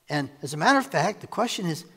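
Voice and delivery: ordinary delivery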